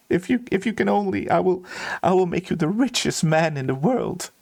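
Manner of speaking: high, anguished acted begging; desperate pleading. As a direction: plead tearfully, bargaining for a pet's life. Steward begs for dying bird.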